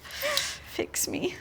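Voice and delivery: playful whisper. Pretend. Tangled wire, joking plea.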